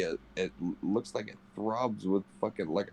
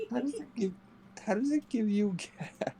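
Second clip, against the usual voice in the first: laughing disbelief. Real, not acted. Laughing disbelief at quoted line.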